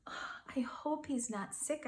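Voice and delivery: whispered worried thought; soft unvoiced fretting. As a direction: breathe it out, anxious. Worried thought inside story.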